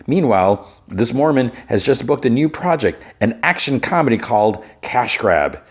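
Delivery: punchy, lilting TV gossip-host delivery; entertainment news read. Acted.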